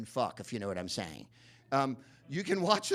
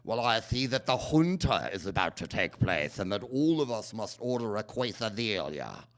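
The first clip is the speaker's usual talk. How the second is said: a hammy fake Latin accent, Boris Karloff style, louder; Jeremy Irons' Latin accent; 5 dB louder than usual. Mocking Jeremy Irons in House of the Spirits with a hammy fake Latin accent. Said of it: "His idea of a Latin accent is to talk like this".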